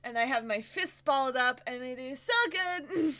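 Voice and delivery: pushy made-up accent, fists balled; Pedor voice. Shown as a demonstration. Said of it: "when I do Pedor".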